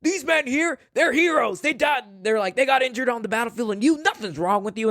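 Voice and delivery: high, raging rant; angry yell. Retold or imitated. Patton raging at the soldier.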